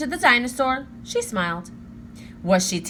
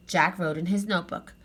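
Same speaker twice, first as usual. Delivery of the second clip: plain reading voice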